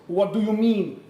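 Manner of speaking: shaky first stab at a Russian character; Russian accent. Acted. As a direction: attempt a big Russian bear's accent, badly. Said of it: "he's Russian, he's gonna talk like the big Russian bear".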